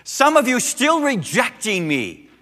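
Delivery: preaching as Jesus. Acted. Preacher speaking as Jesus to an unbelieving generation, impassioned and loud. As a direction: cry out as a weary, pleading Jesus.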